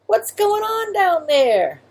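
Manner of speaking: high, slow call from upstairs; mother calling. Acted. Mother calling down from above, slow call. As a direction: call down to the child. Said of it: "a voice called out".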